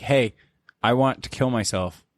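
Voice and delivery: usual speaking voice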